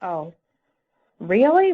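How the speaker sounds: usual speaking voice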